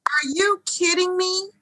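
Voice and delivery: incredulous. Acted. Incredulous exasperated question.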